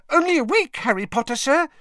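high, loud, cheerful elf squeak; happy elf voice. A narrator's reading. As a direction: chirp it happily like an elf. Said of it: "said Dobby happily".

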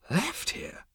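whispery high-pitched query; baffled disbelief. Acted. Zaphod hears he left here earlier, baffled. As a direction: echo it softly in disbelief.

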